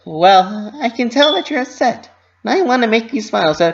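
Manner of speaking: high, bouncy cartoon pony voice; Pinkie Pie voice. A narrator's reading. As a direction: voice a cheerful pony fretting to herself. Pinkie pleads.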